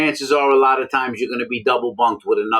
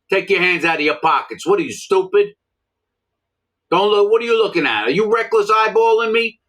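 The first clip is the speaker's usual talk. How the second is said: abusive prison guard; 7 semitones higher than usual. Retold or imitated. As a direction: yell down at the prisoner like a bullying officer. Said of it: "That's the way the officers talk to you".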